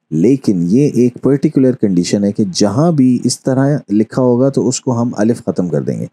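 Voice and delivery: ordinary delivery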